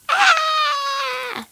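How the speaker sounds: high, drawn-out scream of pain; hammed-up high shriek, held long; giant's scream. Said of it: "And the giant screamed".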